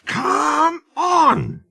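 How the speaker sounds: high, strained puffing; straining. A narrator's reading. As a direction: strain and puff hard. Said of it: "he puffed".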